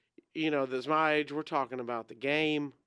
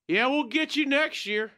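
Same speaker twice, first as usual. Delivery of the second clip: higher, heckling shout across the crowd; retold heckler yell; 8 semitones higher, 3 dB louder than usual. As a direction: call it out like a rowdy fan mocking a rival. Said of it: "people's yelling all kind of stuff".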